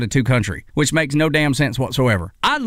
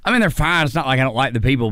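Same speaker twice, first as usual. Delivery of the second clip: ordinary delivery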